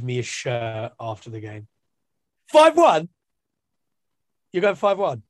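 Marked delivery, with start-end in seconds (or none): 2.5-5.2 high, loud disbelief; can't believe the score; incredulous. Real, not acted.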